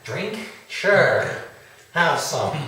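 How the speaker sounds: high, loud tipsy offer; drunk voice. Acted. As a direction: slur it like a happy drunk. Drunk character offers whiskey.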